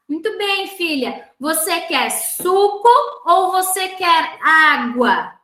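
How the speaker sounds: mum offering a slow, clear choice; encouraging mother. Acted. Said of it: "Deixa sempre o que ela quer por último".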